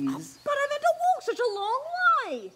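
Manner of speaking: high fast whine; whiny complaint. Acted. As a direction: whine about the long walk. Whining trader.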